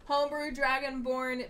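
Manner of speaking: ordinary delivery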